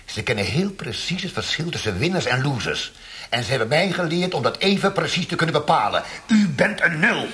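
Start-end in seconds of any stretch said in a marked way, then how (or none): 3.3-7.3 climbing higher and louder, slowing on the insults; building to a furious tirade. Acted. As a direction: hammer home that he is nothing. Interrogator builds to a rant.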